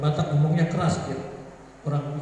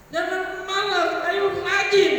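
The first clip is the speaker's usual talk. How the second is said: mock angry scolding; 14 semitones higher, slower than usual. Shown as a demonstration. Preacher demonstrating anger that builds up, high mock scolding. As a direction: urge a lazy child, high and sharp. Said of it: "walaupun dalam nada marah".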